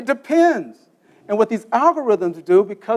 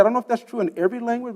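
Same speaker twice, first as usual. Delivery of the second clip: ordinary talk, nothing marked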